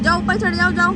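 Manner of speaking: high loud coaxing over the fair noise; loud urging. Real, not acted. Urging child onto the ride. (मेले के शोर में बच्चे को ज़ोर से उकसाना)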